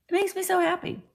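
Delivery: ordinary talk, nothing marked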